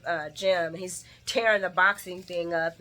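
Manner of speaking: usual speaking voice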